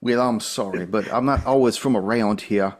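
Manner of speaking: folksy put-on Southern accent; lightly hammed-up Southern accent; Southern officer accent. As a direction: answer as an apologetic Southern officer. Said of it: "sounds a little bit like Foghorn Leghorn".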